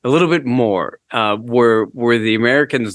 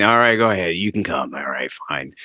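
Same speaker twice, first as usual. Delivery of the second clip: begrudging; 5 semitones lower than usual. Retold or imitated. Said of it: "begrudgingly they signed".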